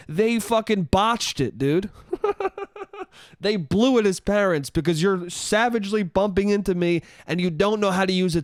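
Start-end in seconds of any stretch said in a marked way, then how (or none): 2.1-3.0 short high-pitched laugh. Real, not acted. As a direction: burst out laughing. Laugh at own rant.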